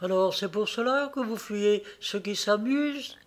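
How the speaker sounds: reproche triste et doux, un peu plus haut; tristement. A narrator's reading. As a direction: une pointe de tristesse dans une voix de jeune fille. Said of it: "reprit-elle tristement". (tristesse contenue)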